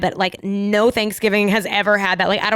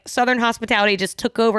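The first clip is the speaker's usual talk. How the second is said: ordinary talk, nothing marked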